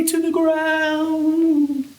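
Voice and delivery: high, long-stretched words; slow, strained high voice; bending to the ground. Pretend. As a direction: stretch it out as you bend over. Acts trees bending all the way down.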